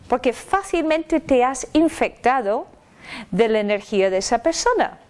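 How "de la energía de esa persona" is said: normal talking voice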